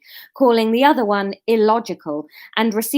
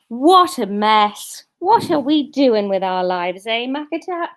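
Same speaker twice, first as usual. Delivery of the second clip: ordinary delivery, nothing marked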